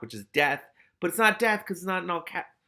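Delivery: plain reading voice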